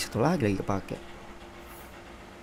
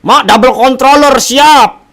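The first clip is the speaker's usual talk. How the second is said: much higher, very loud shout; loud gamer holler; 12 semitones higher, 13 dB louder, slower than usual. Real, not acted. Gamer calling out the double-controller line-up to the team, loud high shout. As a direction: holler it out at the top of your voice.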